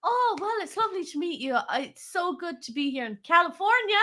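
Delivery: put-on high, quick, gushing southern American accent; hammed-up southern US accent, racing along; mock southern American. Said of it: "Although I sounded more, like, southern".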